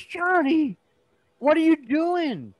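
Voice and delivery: high, loud, drawn-out mock yell; imitated scandalized cry, stretched out. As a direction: yell like a shocked parent in a joke. Dad catches son, mirrors earlier yell.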